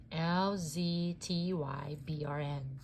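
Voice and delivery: usual speaking voice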